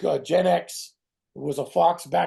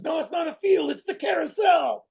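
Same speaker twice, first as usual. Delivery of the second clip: mock tv detective shout; 12 semitones higher than usual. The actor mocks an old TV cop, shouting. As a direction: bark it like a cheesy seventies detective.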